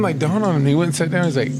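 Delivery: ordinary delivery, nothing marked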